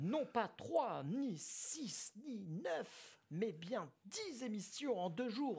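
high, slow, grand stage voice; theatrical declamation. Pretend. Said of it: "Tu veux que je la déclame en mode, euh, théâtre?".

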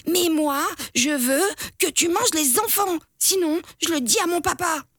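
put-on high, stamping kid's tantrum. A narrator's reading. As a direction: throw a tantrum. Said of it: "Biquet pique une colère".

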